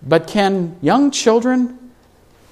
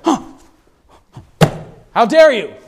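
a huffy, affronted How dare you, pitched up; mock indignation; 7 semitones higher than usual. Preacher imitating a driver cut off in traffic, mock indignant. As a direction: play at being offended by a driver. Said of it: "Indignant just brings up like".

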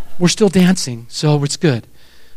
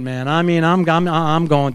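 ordinary talk, nothing marked

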